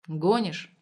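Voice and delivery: plain reading voice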